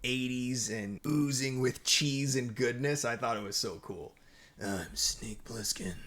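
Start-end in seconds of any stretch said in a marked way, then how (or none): 4.6-5.9 slow, lightly gritty tough-guy line; a hint of a gravelly action hero; Snake Plissken impression. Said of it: "That was a pretty good impression".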